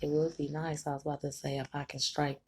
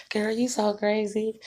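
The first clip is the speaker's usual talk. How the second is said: higher and louder, amused mama voice; mama teasing; 7 semitones higher, 6 dB louder than usual. Retold or imitated. Mama cracking up at her daughter, higher amused teasing. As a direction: tease like a laughing mother.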